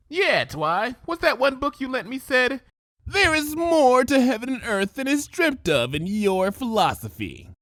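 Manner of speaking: Rainbow, teasing. Acted. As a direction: tease her friend with a quote. Rainbow quoting dramatically.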